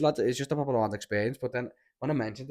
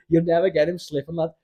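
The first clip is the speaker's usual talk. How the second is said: ordinary delivery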